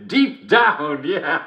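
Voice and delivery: high, gleeful echo of the punchline; laughing. Acted. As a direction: repeat it through your laughter. Repeats punchline while laughing.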